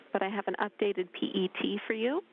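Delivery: ordinary delivery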